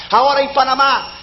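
usual speaking voice

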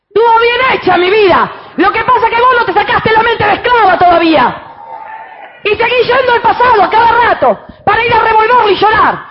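loud, high, fervent preacher shouting; full-force preaching. Acted. Pastor preaching to the women, shouting with fervor. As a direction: preach it out to the whole church.

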